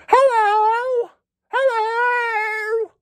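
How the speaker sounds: very high, squeaky mock "Hello!"; mocking announcer catchphrase. Mocking the Raptors announcer's catchphrase, high squeaky yelp. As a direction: parody the announcer's signature call. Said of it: "That's the shittiest catchphrase".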